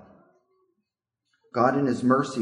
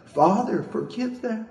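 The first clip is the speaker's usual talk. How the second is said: Jesus on the cross; 11 semitones higher than usual. Acted.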